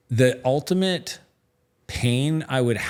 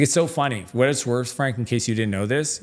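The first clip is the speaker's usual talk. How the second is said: normal talking voice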